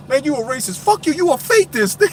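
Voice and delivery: high, squeaky indignant comeback; mock argument voice. Streamer acting out an online argument, high and indignant. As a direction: play the outraged arguer.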